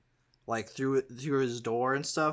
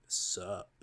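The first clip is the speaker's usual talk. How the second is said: grinning dude voice; 2 semitones lower, 7 dB louder than usual. Acted. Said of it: "Gorbo just looks and he grins and he goes".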